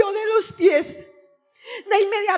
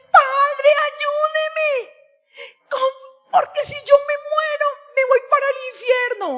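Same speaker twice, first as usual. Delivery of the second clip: tearful high plea to the priest, words rushing; crying plea; 8 semitones higher than usual. Retold or imitated. Begging a young priest for help, crying and hurried. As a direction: beg the priest through tears, faster at the end. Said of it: "Y me fui a buscar un sacerdote llorando".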